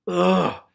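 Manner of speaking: loud grossed-out groan; a real groan of disgust; disgusted ugh. Real, not acted. Reader disgusted by the mud.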